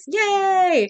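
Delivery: high, drawn-out "yay"; little cheer. Real, not acted. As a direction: cheer the villain's death in a high voice. Cheering the villain's death.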